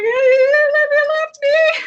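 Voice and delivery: high, drawn-out sobbing wail; mock wailing sob. Camper bawling to the camp mom in the parking lot, high sobbing wail. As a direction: stretch it out as a blubbering cry. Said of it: "And I'm like".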